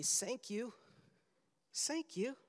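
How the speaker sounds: small, high, soft voice; little child's thanks. Retold or imitated. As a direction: imitate a tiny child saying thanks. Voices small girl opening presents.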